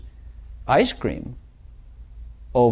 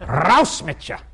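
mock German; 4 semitones higher, slower than usual. Mock German harsh phrase.

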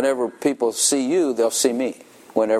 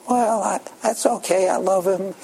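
put-on young woman's reply; 8 semitones higher than usual. Mary answers the question.

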